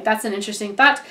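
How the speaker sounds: usual speaking voice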